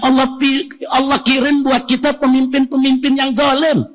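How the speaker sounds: high-pitched, forceful sermon cry; full-force preaching. Acted. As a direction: hammer it out to the crowd, pitch held high.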